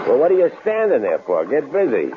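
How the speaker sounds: impatient order. Acted. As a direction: hurry her up with menace. Killer bosses her around.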